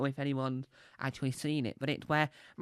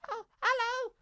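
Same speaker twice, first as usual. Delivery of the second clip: very high squeaky puppet voice; Elmo impression. Comic doing Elmo as the Die Hard hero, squeaky. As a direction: squeak it like a tiny red puppet. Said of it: "This is my impression of Elmo".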